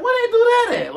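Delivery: high, loud disbelief; baffled question. Retold or imitated. The victim of mind games asking in high disbelief. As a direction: squeak it out, baffled. Same words said twice.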